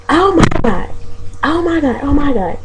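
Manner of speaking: high-pitched imitation of a shocked customer; mock shock. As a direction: exclaim it high, mimicking her amazement. Acting out the woman's shocked reaction.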